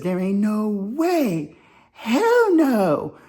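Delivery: mock-outraged bystander, stretched high; indignant disbelief. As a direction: draw it out high in imitated disbelief. Retells a bystander's outraged reaction.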